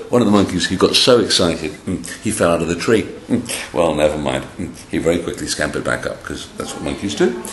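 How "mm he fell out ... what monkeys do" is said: ordinary delivery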